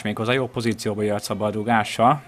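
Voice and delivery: ordinary delivery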